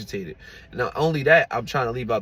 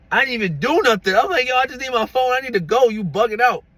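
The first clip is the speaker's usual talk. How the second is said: higher, louder exasperated protest; mad; 13 semitones higher, 7 dB louder than usual. Retold or imitated. Telling the girlfriend he just needs his phone, angry and raised. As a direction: push it up, fed up and angry. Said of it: "I was so mad".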